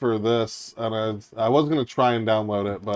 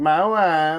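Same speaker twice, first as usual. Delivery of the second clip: lightly voiced Borat accent, louder; quick Borat catchphrase; 5 semitones higher, 4 dB louder than usual. Friend showing the Borat joke, light Borat accent. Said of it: "I say that shit all the time".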